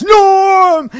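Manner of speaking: high, loud drawn-out mock cheer; barroom greeting shout. Host acting out the Cheers crowd greeting, playful shout. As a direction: bellow it like a whole bar hailing a regular. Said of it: "everybody shouts".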